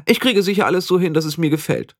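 put-on high-pitched, self-assured young witch; conceited young witch, eager. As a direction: chatter on in a high, vain voice. (eingebildete junge Hexe, hohe Stimme)

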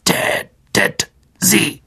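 high, very slow creature order; a grumpy ancient monster giving a death order; irritated spider. A narrator's reading. Aragog orders the kill, irritated high creature voice. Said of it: "klickte Aragog gereizt".

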